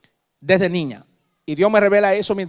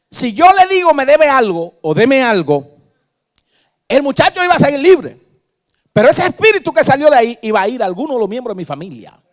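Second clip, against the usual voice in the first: high, loud preacher's cry; fervent pulpit exhortation; preaching at full force; 9 semitones higher, 11 dB louder than usual. Acted. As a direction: shout it out to the congregation.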